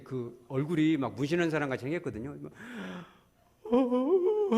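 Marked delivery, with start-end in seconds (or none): 3.6-4.6 high broken crying cries; imitated sob. Pastor imitating a crying male patient with high sobs. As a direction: choke out sobbing sounds. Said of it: "막 눈물이 뚝뚝 떨어져요".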